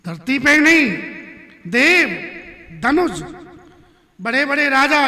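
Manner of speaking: high, shouted heroic proclamation, easing toward the end; defiant challenge. Acted. Ram tells Parshuram he will fight any challenger, loud and defiant. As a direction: ring out each rank of foe in bursts.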